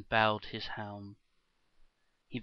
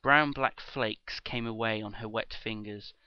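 usual speaking voice

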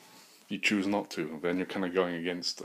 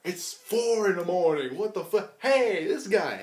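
high, slow, loud mock complaint; drawn-out, hammed-up high voice; woken householder; 11 semitones higher, 5 dB louder, slower than usual. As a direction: play a homeowner woken by an intruder. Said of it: "I just walk into people's houses".

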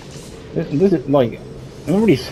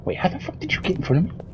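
startled whisper; 5 semitones higher, 7 dB quieter, faster than usual. Real, not acted. Monster suddenly appears ahead.

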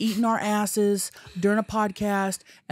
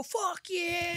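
mom's fuck you; 9 semitones higher, 8 dB quieter than usual. Retold or imitated. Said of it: "yelling 'fuck you'".

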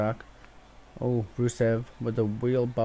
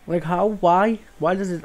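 high, louder pleading protests; exasperated whys; 6 semitones higher, 5 dB louder than usual. Real, not acted. Gamer protesting to the game, high exasperated whys. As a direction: throw the questions at the screen.

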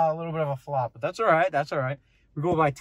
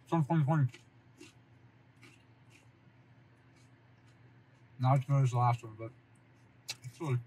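speaking between chews; talking while chewing; 2 semitones lower, 5 dB quieter, faster than usual. Real, not acted. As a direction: mouth partly full of pizza. Seen 1: Bit pizza at -5, jaw still working while talking after.

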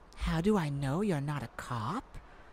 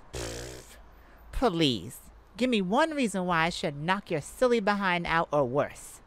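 normal talking voice

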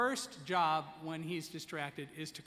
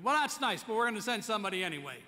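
insistent firefighter; 5 semitones higher, 8 dB louder, faster than usual. Retold or imitated.

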